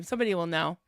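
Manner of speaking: normal talking voice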